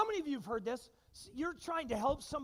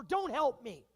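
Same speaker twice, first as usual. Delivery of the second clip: stubborn refusal; 6 semitones higher, 9 dB louder than usual. Retold or imitated. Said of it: "have you heard this one before?".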